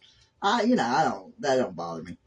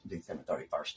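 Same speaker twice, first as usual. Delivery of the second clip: much lower and softer, half to herself; low aside; 9 semitones lower, 11 dB quieter, faster than usual. Acted. Mother repeating her son's birth date to herself, low and soft. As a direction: drop the date under the breath. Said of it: "December thirty-first of nineteen ninety-nine".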